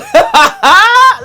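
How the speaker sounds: a burst of high, bright giggling; squealing laugh at her own joke; high giggle. Real, not acted.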